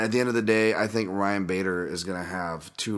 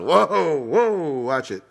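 mock alarm; 7 semitones higher, 6 dB louder, much slower than usual. Mock alarm at own joke.

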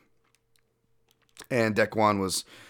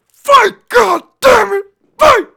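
slow, much higher, loud mock yell; imitating a yelling dad; 19 dB louder, slower than usual. Imitating the dad yelling from the corner, mock scream. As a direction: bellow at your son like a furious corner dad. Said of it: "you just hear his dad go".

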